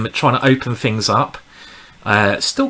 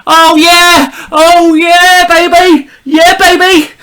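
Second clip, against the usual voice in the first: ecstatic celebration; 9 dB louder, slower than usual. Real, not acted. Streamer celebrating getting his favourite opening, ecstatic cry. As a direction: crow it out in triumph, much higher and louder. Gets the King's Gambit, celebrating.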